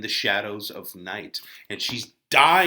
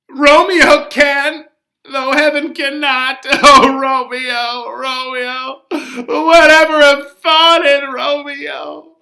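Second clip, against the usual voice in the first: loud, sobbing cries of Romeo; the nurse breaks down, calling his name; crying nurse; 14 semitones higher, 14 dB louder, slower than usual. Acted. Nurse grieving over Tybalt.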